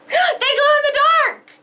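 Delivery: high, loud delighted cry; excited discovery. Real, not acted. Finding the gloves glow, an excited cry. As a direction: cry out with joy.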